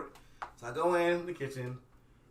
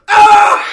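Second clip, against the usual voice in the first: high, loud sudden shriek; imitated scream; 12 semitones higher, 22 dB louder than usual. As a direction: scream at a bug on your leg. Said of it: "looks at it, goes".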